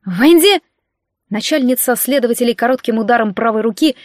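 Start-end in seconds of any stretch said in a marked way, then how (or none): 0.1-0.6 sharp, high, loud bark; sudden loud command. Acted. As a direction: bark the name as a signal. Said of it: "рявкнула".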